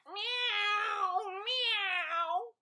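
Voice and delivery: high, loud mock meow; cat meow. Imitating cat.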